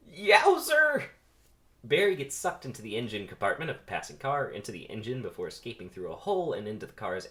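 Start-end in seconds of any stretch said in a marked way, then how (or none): 0.1-1.1 very high, loud yelp; wild excited cry; yowzer. Acted. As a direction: shriek as you fly off. Barry flung into air.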